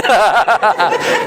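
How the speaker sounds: amused laugh breaking out; hearty laugh. Real, not acted. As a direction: laugh out at the memory. Laughing at own story.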